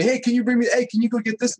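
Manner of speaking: high-pitched imitation of an employee's request; mock employee. Contractor imitating his employee calling him on the job, high mock voice. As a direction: voice the employee nagging for help.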